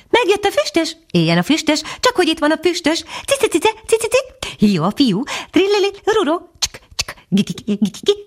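high twittering bird chorus, cheerful; chirping birds. A narrator's reading. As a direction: chirp the welcome like a flock of birds. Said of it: "hangzott nagy összevisszaságban".

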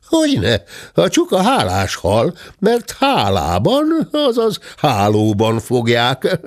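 a cheeky high servant voice; high-voiced servant bear. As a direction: lift the voice, sly and quick-witted. Bátya's higher figure voice.

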